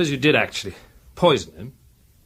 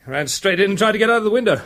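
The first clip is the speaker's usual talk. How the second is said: usual speaking voice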